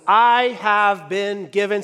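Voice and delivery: spoken slowly and firmly for the congregation to echo; slow call-and-response line. Shown as a demonstration. Pastor leading the congregation in a slow spoken echo. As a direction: lead the room, word by word. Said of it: "say it with me".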